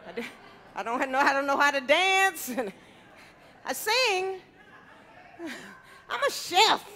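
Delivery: normal talking voice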